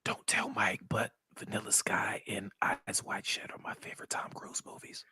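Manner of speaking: hushed, secretive whisper; confiding whisper. Pretend. Co-host secretly confessing his favorite movies, confiding whisper.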